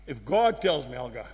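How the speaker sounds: hushed low echo of the vow; quiet repeat. Shown as a demonstration. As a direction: drop the voice to a low hush. Repeats vow, much lower, softer.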